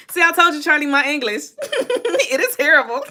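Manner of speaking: laughing at herself. Real, not acted. Laughing at own English.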